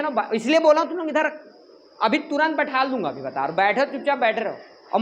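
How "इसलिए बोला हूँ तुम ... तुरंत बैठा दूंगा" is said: normal talking voice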